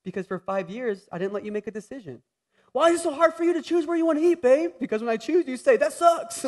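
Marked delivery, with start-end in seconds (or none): 2.7-4.7 high, fast, exasperated mock of his wife; a high, rushed wifely whine; imitating wife. Voicing his wife's complaint. 5.8-6.4 mock wife. Voicing wife's dismissal.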